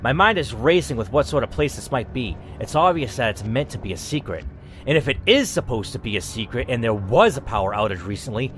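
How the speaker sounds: ordinary delivery, nothing marked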